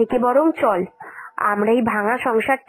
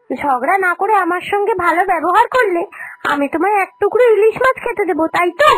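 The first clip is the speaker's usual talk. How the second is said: sarcastic taunt; 7 semitones higher than usual. Acted. Younger sister-in-law mocking her elder over the fish, sarcastic and higher. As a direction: needle her with scorn, voice pitched up.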